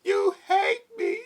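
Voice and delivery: high wail cracking into laughter; laughing fake sob. Mocking the crying sister, laughing through a high wail. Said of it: "she just started crying".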